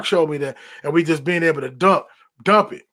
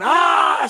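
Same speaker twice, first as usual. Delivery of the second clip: scream; 10 semitones higher than usual. Retold or imitated. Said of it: "scream to the top of your lungs".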